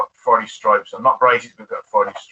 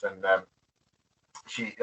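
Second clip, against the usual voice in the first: ordinary talk, nothing marked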